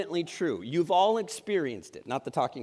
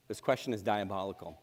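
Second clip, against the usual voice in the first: softer repeat; 9 semitones lower, 7 dB quieter than usual. Shown as a demonstration.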